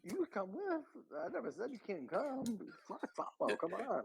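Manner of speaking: very high mock villain whine; squeaky villain voice. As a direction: toss it off in a squeaky cartoon voice. Villain bit continues.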